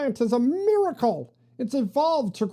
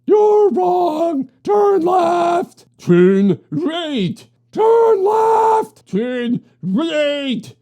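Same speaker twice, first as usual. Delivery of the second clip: yelling back and forth over each other; shouting; 7 semitones higher, 9 dB louder, much slower than usual. Acted. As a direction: shout every order as loud as you can. Heated argument, turn left or right.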